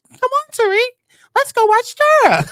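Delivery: mock a four-year-old begging excitedly; childish voice. Mocking Yaz's childish talk again.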